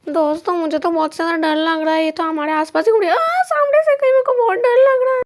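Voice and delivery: fear climbing into a high shriek; frightened yelling. Acted. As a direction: wail to your friends in growing terror. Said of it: "तुम्हारे चिल्लाने से हम लोग फंस जाएंगे". (डर के मारे चिल्लाते हुए)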